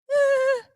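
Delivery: very high, loud squeal of dread; high-pitched "eh" of mock panic; mock panicked squeal. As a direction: let out a hammed-up panicky shriek. Mimes panic at the dentist.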